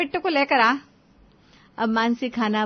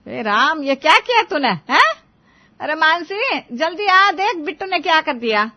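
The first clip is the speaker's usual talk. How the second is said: raised high, loud cry of alarm; shocked yelling; 6 semitones higher, 4 dB louder than usual. Acted. Mother-in-law yells for daughter-in-law after the baby wets her, loud alarm. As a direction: yell for Mansi in a panic.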